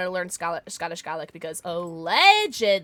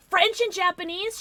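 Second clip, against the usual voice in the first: higher, louder, amazed at the answer; surprised question; 11 semitones higher, 5 dB louder than usual. Real, not acted. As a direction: pitch up in disbelief. Surprised at viewer's languages.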